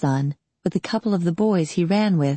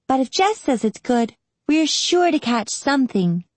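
put-on higher, happy girl's voice; happy reply; 7 semitones higher than usual. A narrator's reading. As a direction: chime in happily as the kid sister. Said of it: "replied Rinny happily".

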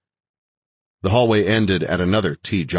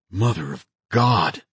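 slow, stunned exclamation; gasped; 2 semitones higher, slower than usual. A narrator's reading. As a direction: draw it out in shock, leaning on God. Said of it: "gasped Bunny".

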